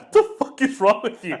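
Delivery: loud, high retold outrage with laughter; laughing disbelief. Son reacting to the shower crying story, laughing disbelief. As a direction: blurt it out incredulously through a laugh.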